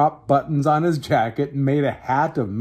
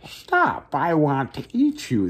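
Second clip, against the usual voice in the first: hungry mewing cat; slower than usual. Acted. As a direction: mew it with hunger. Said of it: "the cat mewed hungrily".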